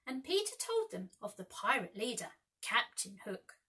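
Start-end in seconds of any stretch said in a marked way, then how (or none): none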